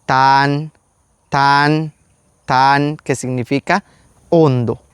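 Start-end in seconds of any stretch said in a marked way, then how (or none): 0.0-3.0 «tan» with a short a and a plain T; «tan» said crisply; short vowel. Shown as a demonstration. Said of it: "La primera es". («tan» con vocal corta)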